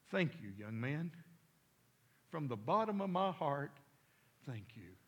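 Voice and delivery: sincere, softening to a near hush; heartfelt thanks. Retold or imitated. Old man thanks the boy who saved him, heartfelt. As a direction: thank him earnestly, letting the voice sink.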